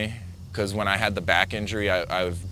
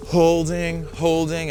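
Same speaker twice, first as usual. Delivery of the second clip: higher, stretched strain; higher, tense repeated holding; 6 semitones higher, slower than usual. Retold or imitated. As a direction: hold the word like you're hanging on. Clinging as water sucks out.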